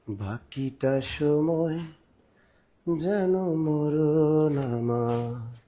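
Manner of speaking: slow, soft, tender poem recital; poem recitation. Acted. Teacher reads a love poem to the class, slow and soft.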